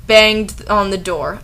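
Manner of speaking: ordinary delivery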